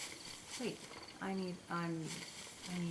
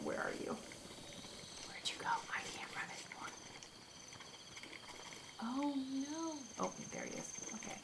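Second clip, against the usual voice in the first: whisper; 5 semitones higher, 10 dB quieter than usual. Real, not acted. Hunting the hidden boar quietly.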